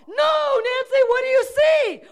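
very high, exasperated demand; insistent cry. Retold or imitated. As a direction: cut in and demand the answer. God growing more exasperated in retelling.

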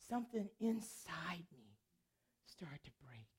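soft, breathless whisper fading out; tearful whisper. Retold or imitated. Roommate confessing as she weeps, hushed tearful whisper. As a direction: let it break into a weep. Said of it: "she just started to weep".

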